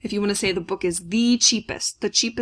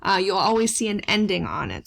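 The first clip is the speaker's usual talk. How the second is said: normal talking voice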